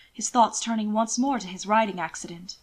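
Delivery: ordinary talk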